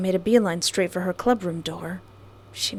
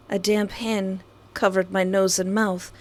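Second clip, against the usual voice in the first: ordinary talk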